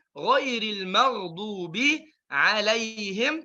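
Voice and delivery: slow chant; Quran recitation. Shown as a demonstration. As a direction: recite melodically. Recites the verse.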